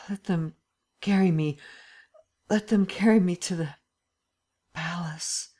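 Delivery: halting, broken phrases fading to whisper; groggy torpor. A narrator's reading. Drugged Lupin asks to be carried, groggy torpor. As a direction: labour out each phrase, half conscious. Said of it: "in the midst of his torpor".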